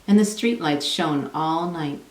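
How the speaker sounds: ordinary delivery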